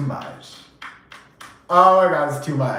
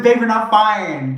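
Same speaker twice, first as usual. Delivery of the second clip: higher, louder, slow insisting; insistent mock scolding; 7 semitones higher, 5 dB louder than usual. Woman insisting her date take the gum, higher louder slow scolding. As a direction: press the point, spelling it out.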